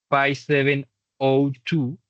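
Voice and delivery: slow, slightly lifted number reading; digit by digit. Shown as a demonstration. Teacher modelling the phone number for learners, digit by digit. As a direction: dictate the digits slowly, one by one. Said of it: "es mejor decirlos uno por uno".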